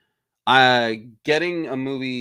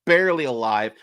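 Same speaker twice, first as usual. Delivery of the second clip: ordinary delivery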